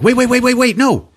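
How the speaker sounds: frantic to stop him; mock panicked pleading. Imitating Finn and Rose stopping the boy from raising the alarm, panicked. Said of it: "And they're like".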